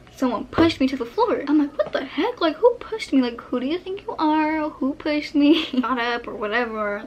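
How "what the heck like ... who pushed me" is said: higher-pitched, half-amused indignation; indignant outburst. Retold or imitated. Just shoved to the floor, demanding who did it, indignant. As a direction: act out outraged disbelief, pitched up. Acts out reacting to a push.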